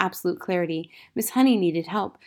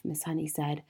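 ordinary delivery

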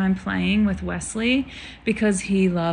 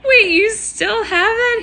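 high, laughing mock outrage; laughing retelling; 8 semitones higher, 4 dB louder than usual. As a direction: pitch it way up, laughing through the quote. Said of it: "And he's just like".

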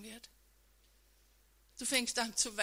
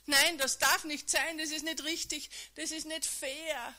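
higher, louder protest against injustice; inner outcry; 5 semitones higher, 6 dB louder than usual. Acted. Listener silently crying out as Jesus carries the cross, raised anguished voice. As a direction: cry out in despair inside. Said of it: "in deinem Kopf schreist du".

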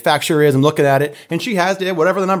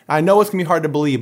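normal talking voice

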